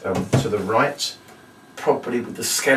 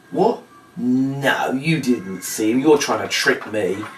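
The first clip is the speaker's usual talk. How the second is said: mock surprise; 2 semitones higher, slower than usual. Shown as a demonstration. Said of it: "and goes".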